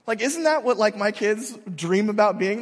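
usual speaking voice

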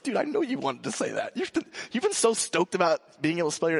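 gently laughing through the words. Retold or imitated. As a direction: chuckle as you tell the kid you understand. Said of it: "I was like".

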